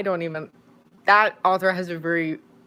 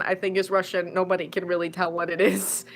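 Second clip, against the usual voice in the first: ordinary talk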